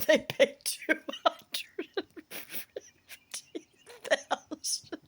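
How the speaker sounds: high, squeaky laughing, slow and breathless; trying to talk while cracking up; laughing through words. Real, not acted. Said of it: "I apologize for the laughter".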